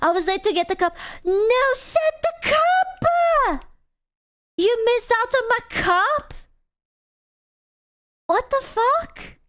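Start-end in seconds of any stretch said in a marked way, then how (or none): 1.2-9.4 very high, drawn-out squeal of disbelief; mock outrage. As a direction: wail high and slow that they missed it. Outraged that chat missed her cup.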